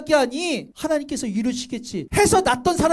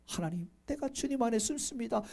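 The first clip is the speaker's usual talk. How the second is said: very soft, sheltering prayer; acted surrender to God; hushed prayer; 12 dB quieter than usual. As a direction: drop right down and pray quietly. Acting a believer's prayer.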